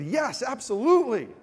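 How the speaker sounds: a loud, high, thrilled yes; eager yes. Retold or imitated. Accepting the ESPN job offer on the spot, loud and thrilled. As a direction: blurt out your acceptance, overjoyed.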